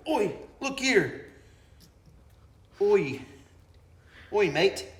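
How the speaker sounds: high-pitched put-on Cockney Aussie; mock Cockney Australian. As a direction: call out in a hammed-up Cockney Australian accent. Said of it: "Or more Cockney Australian".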